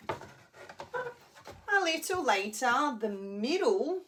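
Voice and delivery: slow, high storybook narration; drawn-out narration. A narrator's reading. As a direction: stretch it out like a story.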